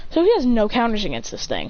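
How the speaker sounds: plain reading voice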